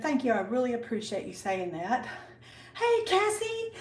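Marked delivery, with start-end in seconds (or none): 2.7-3.7 high-pitched hello; real high, bubbly welcome; excited greeting. Real, not acted. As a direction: call out happily as a friend arrives. Greets another friend joining.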